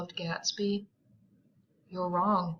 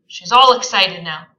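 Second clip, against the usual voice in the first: loud insistence; 14 dB louder than usual. Acted. Gatsby continues insisting.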